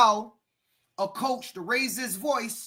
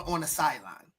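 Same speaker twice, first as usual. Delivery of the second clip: ordinary talk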